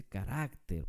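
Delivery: carácter stressed on the middle syllable, ka-RAK-ter; correct stress. Shown as a demonstration. Teacher showing the correct word, carácter stressed on the middle syllable. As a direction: say carácter as "ca-RÁC-ter", leaning on the second syllable. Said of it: "se dice carácter".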